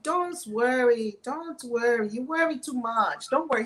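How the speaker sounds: imitated Omani accent, hard T on "don't". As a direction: mimic an Omani man reassuring someone. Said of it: "He's saying all this in his accent from Oman".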